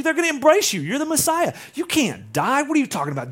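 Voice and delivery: agitated, high and loud campaign chief; Peter. Acted. Chief of staff correcting his candidate's stump speech, agitated. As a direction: talk the candidate down in urgent, exasperated protest. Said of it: "goes into the mode like a politician".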